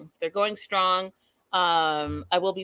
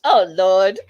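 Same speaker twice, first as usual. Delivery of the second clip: exasperated exclaim; 8 dB louder, much slower than usual. Real, not acted. Reacting to the creepy call.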